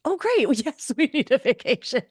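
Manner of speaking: high, loud laughing relief; giddy relieved laughter. Retold or imitated. Mock relief after bracing.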